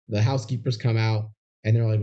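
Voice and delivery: ordinary delivery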